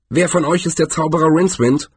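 snarled demand. A narrator's reading. Heroine with drawn sword demands the wizard, mildly snarling. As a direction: snap it out as a quick demand. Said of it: "fauchte sie".

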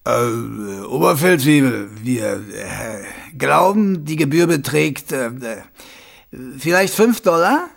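stockend, angetrunkene Männerstimme; betrunken und ängstlich. Acted. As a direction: ein ängstlicher Säufer druckst herum. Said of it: "Alkohol schwang darin mit, außerdem eine auf die Blase schlagende Furcht". (Betrunkener stammelt voller Angst einen Bestechungsversuch)

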